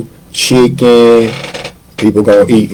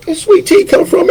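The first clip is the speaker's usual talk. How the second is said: high mock-amazed question, pitched way up; surprised question. Telling the host how surprised he was, mock amazed and high. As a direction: ask it like you can't believe it's there.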